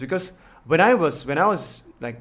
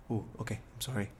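retold in a small, low, very soft voice; meek apology; 4 semitones lower, 15 dB quieter, faster than usual. Younger self apologizing to his scolding coach, meekly. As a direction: drop right down, like someone just told off.

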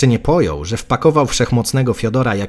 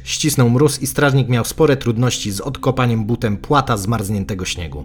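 ordinary delivery

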